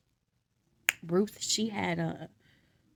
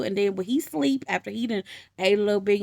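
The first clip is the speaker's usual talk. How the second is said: ordinary talk, nothing marked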